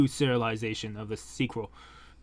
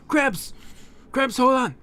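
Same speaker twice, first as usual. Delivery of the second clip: high panicked yell, louder; frantic shout; 11 semitones higher, 4 dB louder than usual. Acted. Percival yelling at his collapsing grandfather, panicked. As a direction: scream for the dying grandfather.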